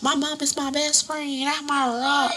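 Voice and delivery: mock gushing. Mimicking people whose mom is their best friend, mock gushing.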